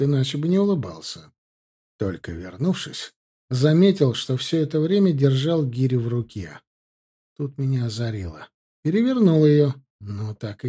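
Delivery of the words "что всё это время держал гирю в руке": plain reading voice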